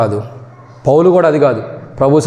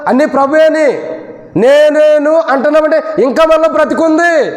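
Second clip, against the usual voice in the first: building sermon climax; 10 semitones higher than usual. Acted. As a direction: build it up to a ringing call. Preacher builds to full force. (క్రమంగా పెరిగే బోధన స్వరం)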